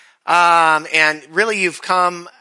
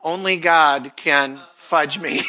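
reading a misspelt tattoo to the congregation, slow and deadpan; slow reveal; slower than usual. Shown as a demonstration. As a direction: read it out slowly, deadpan. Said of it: "See if you can figure this one out".

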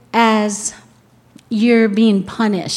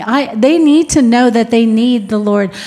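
normal talking voice

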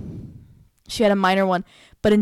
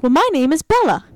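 put-on high, louder little-kid voice; cute little girl; 7 semitones higher, 4 dB louder than usual. Little girl introducing herself in several languages, cute childlike voice. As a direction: imitate a small girl cutely introducing herself. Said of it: "it's so cute the way she does it".

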